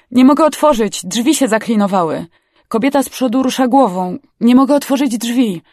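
high, loud urgent report; urgent shouting. A narrator's reading. Rescuer shouts about jammed car doors, urgent.

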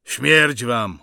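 loud defiant shout; father's last defiant cry; prisoner's yell. A narrator's reading. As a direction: yell death at your executioners. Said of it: "wrzasnął starszy".

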